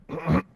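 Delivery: short throaty grunt; throat-clearing grunt from the Inquisitor; warning grunt. Acted. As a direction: grunt to halt someone. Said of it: "a bit of a grunt from the Inquisitor".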